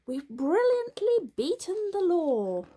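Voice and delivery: high and loud gloating; gloating boast. A narrator's reading. Robbers boasting they beat the law. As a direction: crow over your victory.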